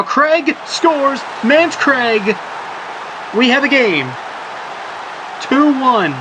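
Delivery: soaring high excited goal call, slowing on the scorer's name. Real, not acted. Commentator calling the equaliser goal for the viewers, thrilled and high. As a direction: bellow the goal like the stadium just erupted.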